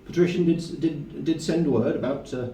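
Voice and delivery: high-pitched, obliging servant; servant character. Acted. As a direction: answer the door as a high-voiced servant. Game master plays the servant Colin.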